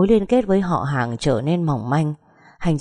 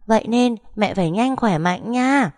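put-on higher, bold little boy voice; boy speaking up bravely; 6 semitones higher than usual. A narrator's reading. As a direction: puff up and reassure his mother. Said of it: "lớn giọng nói".

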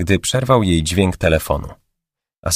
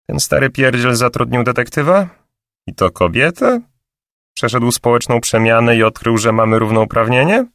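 higher, sneering character voice; scornful woman; 5 semitones higher than usual. Acted. Assistant mocking the boss's new detective, scornful and higher. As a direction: scoff at the old man's hiring a woman.

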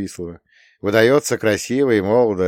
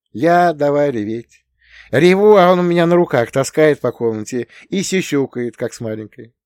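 Nastya's voice; 7 semitones higher, 7 dB louder than usual. A narrator's reading. Nastya still voiced higher.

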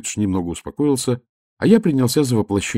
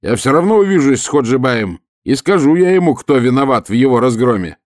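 very loud, high yelling; louder shout; 4 semitones higher, 6 dB louder than usual. Acted. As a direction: shout with all your might. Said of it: "закричал ещё сильнее".

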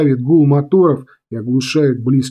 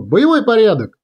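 high-pitched military call to formation; battle command; 7 semitones higher than usual. A narrator's reading. Commander ordering planes into battle order, commanding call.

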